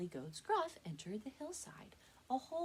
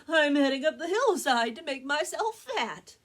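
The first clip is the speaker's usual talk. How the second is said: second goat voice; 8 semitones higher, 14 dB louder than usual. Acted.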